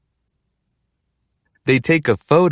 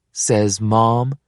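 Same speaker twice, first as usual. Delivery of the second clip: ordinary delivery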